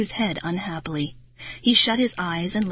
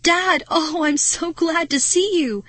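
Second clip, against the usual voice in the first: high, loud, excited greeting; relieved cry; 9 semitones higher, 4 dB louder than usual. A narrator's reading. Girl greeting her dad, hugely relieved. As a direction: gush with relief at being found.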